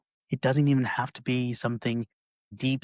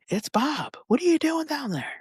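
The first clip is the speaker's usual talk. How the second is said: much higher calling voice; Bob calling down. Retold or imitated. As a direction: call down to a friend in a pit. Bob calls into hole.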